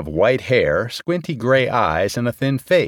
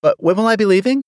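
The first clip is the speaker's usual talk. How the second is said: fast, much higher question; quickly; 11 semitones higher, 4 dB louder, faster than usual. A narrator's reading. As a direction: hurry the question in to change the subject. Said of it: "Murphy said quickly".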